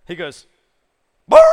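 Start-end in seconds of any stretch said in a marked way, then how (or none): 1.3-1.5 wild lips-flapping brrr then a shrieking laugh; crazy babble noise. Retold or imitated. To the king of Gath, a mad babbling shriek. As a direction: play the madman, blubbering loud and high. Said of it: "starts acting absolutely insane".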